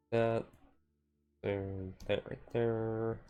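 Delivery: ordinary talk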